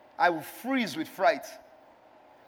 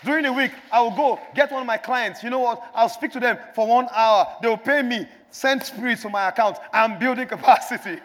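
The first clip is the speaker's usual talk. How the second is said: rising, louder sermon build; preaching; 2 semitones higher, 5 dB louder than usual. Acted. Pastor exhorting the church to build and pour, preaching. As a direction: preach it out to the congregation.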